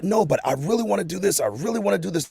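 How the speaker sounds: eager insistence. Retold or imitated. Person begging God to let them do it, eager. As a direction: beg to be allowed to do it. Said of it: "You like".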